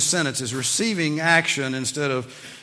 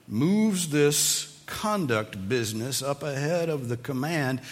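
ordinary delivery

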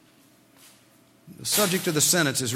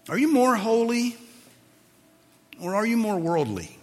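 pitched up, leaning hard on you; pressing question; 9 semitones higher, 4 dB louder than usual. Real, not acted. Preacher questioning the congregation, raised and urgent. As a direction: demand an answer.

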